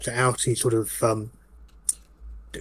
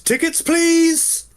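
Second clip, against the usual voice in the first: high, drawn-out conductor's call; 4 dB louder, much slower than usual. Acted. Conductor to the passengers, calling out. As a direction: call it down the train, sustained and high. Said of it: "and calls out".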